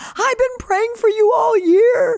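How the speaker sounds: high, slow tearful confession; crying. Retold or imitated. Roommate confessing her prayers, crying. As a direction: sob it out with relief. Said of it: "She starts crying".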